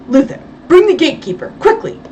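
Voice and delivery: fast, high, loud order. A narrator's reading. Belle calling his servant for the gatekeeper, fast loud order. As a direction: rush the command out. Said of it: "Belle ordered".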